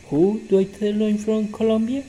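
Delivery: high, rising prompt; bright, lifted classroom question; teacher's quiz question. Shown as a demonstration. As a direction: ask it up high to draw the answer.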